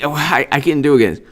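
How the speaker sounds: ordinary talk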